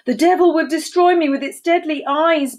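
high, loud and distraught; high-pitched storybook distress; distraught bellow. A narrator's reading. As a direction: bellow it in hammed-up panic. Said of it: "bellowed the distraught Deinonychus".

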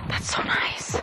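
soft breathy aside; whispering. Real, not acted. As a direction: hush it under your breath. Quiet aside on the hill.

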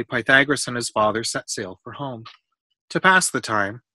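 usual speaking voice

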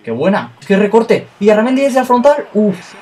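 high, loud excited cry at the shot; excited shout. Real, not acted. Gamer watching a long shot, high excited cry. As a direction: burst out as the shot goes in.

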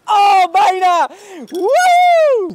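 high, loud, thrilled shout; excited shout. Real, not acted. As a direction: whoop with relief. Near miss on bike.

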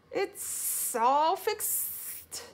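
high, very slow whistled S sounds; whistling Gopher. A narrator's reading. Gopher announcing the sign is mended, whistling. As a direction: draw out each S into a whistle. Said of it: "whistled Gopher".

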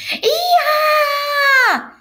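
long, loud, very high shriek; scream. A narrator's reading. Girl screaming suddenly out of nowhere, screaming. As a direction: scream it out at full pitch. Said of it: "Leslie screamed".

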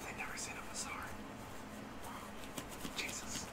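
very soft whispered aside; hushed aside. Acted. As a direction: whisper it under your breath, as if to yourself. Player's soft in-character aside.

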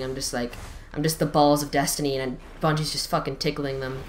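ordinary delivery

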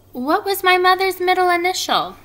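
high, loud little-creature question; second spider's voice. A narrator's reading. Second baby spider asks Wilbur about mother, high piping voice. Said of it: "asked the second spider".

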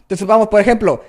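usual speaking voice

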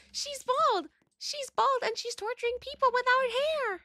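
very high squeaky voice; pitched far up, squeaky and dramatic; high squeal. Pretend. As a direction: squeal it in mock horror. Squealing over bald character.

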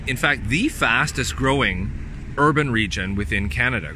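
ordinary talk, nothing marked

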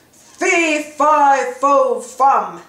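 big booming giant chant; bellowing giant. A narrator's reading. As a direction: boom it out like a giant. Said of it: "bellowed the giant".

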